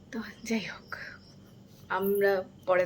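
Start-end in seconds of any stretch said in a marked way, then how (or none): 0.1-1.0 whispering. Real, not acted. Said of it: "আমি আস্তে আস্তে কথা বলছি".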